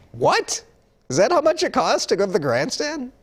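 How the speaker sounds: high-pitched incredulous question; astonished, squeaky-high reaction; shocked disbelief. Real, not acted. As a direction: balk at the price, voice shooting way up. Reacting to the ticket price.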